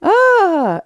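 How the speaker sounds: loud high groan of disbelief; exasperated high cry. Real, not acted. Host groaning high and loud at the absurd quote.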